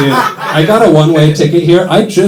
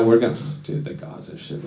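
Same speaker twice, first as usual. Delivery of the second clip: ordinary talk, nothing marked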